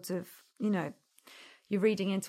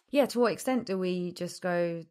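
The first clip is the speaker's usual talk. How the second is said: ordinary delivery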